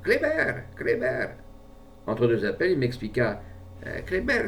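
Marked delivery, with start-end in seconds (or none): 0.0-1.3 high, ringing call to a pet ferret. A narrator's reading. Le maître appelle son furet, d'une voix haute et retentissante. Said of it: "Et il appela d'une voix retentissante". 3.9-4.5 calling the ferret. A narrator's reading.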